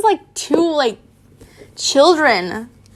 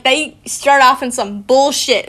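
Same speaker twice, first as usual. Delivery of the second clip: ordinary delivery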